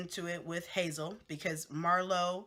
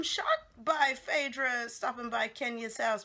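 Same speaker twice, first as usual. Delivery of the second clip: ordinary talk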